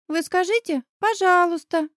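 put-on high child's voice, helpfully prompting; prompting little boy. A narrator's reading. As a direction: pitch it up like a small boy coaching a grown-up. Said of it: "подсказал Ваня". (высоким детским голоском, подсказывая)

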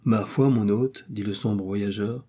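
ordinary delivery

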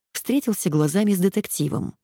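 ordinary delivery

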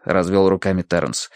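ordinary delivery

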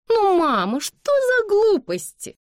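put-on very high, loud, scoffing young lady; mocking. A narrator's reading. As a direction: scoff at mama in a drawn-out high voice. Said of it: "раздался насмешливый голос Бланш". (насмешливо, высоким голосом)

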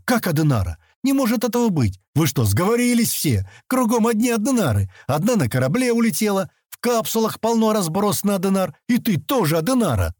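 high-pitched incredulous outburst; exclaiming. A narrator's reading. Sten confronting the girl about her name, incredulous exclamation. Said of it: "воскликнул он".

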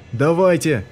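shout. A narrator's reading. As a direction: yell them onward. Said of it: "кричал Полнов".

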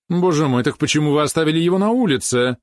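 loud, high alarmed exclamation; exclaiming. A narrator's reading. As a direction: cry out in dismay. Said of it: "воскликнула княгиня". (воскликнуть встревоженно)

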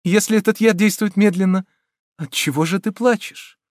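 high, eager appeal; relieved, high-pitched coaxing; hopeful questioning. A narrator's reading. Heroine hopeful, consoling weeping lover.

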